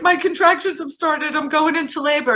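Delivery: high, loud, panicky retelling; panicked in labor. Retold or imitated. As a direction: replay the panic of labor starting. Retold panicked call in labor.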